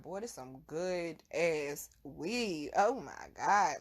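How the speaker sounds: low, very slow, drawn out; slow stoned savoring. Retold or imitated. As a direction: savor every word like the first hit.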